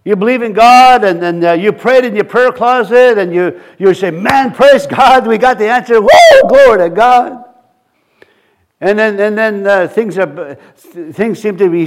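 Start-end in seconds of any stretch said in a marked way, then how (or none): 4.1-7.4 acted elation, building to a loud "Woo"; jubilant praise. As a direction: whoop it up in mock celebration. Acting out joyful answered prayer.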